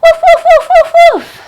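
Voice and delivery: high-pitched playful puppy barks; rapid string of high woofs; doggy woofs. Pretend. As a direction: yap like an excited little dog. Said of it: "Doggy pose".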